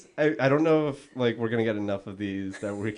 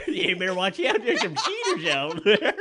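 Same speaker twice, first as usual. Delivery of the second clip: higher-pitched, badly faked chief voice, half laughing; 7 semitones higher than usual. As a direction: do a silly chief impression with laughter creeping in.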